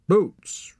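high, loud and curt; a sharp one-word repeat to a boy who didn't listen; impatient repeat. A narrator's reading. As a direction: say it again, short of patience. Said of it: "repeated the woman".

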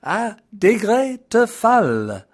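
hammed-up French accent, recited high and grand; horrible French accent. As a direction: declaim it like a proud Frenchman reciting poetry. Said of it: "my horrible French accent".

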